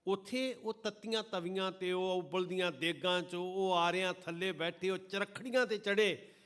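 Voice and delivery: impassioned loud sermon; fervent preaching. Acted. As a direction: thunder it at the congregation. Martyrdom passage, full force.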